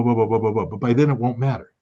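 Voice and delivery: usual speaking voice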